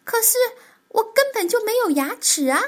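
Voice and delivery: startled protest. Acted. Tiaotiao pulled onto the dentist's chair, startled protest. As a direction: squeak it out in dismay.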